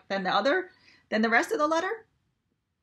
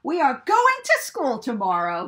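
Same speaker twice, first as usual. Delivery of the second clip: bright announcing farmer, a bit louder; eager Farmer Brown; 3 semitones higher, 7 dB louder than usual. A narrator's reading. Farmer announcing school trip to his cows, eager. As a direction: call the news out to the cows. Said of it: "he told the cows".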